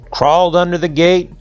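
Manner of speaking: ordinary delivery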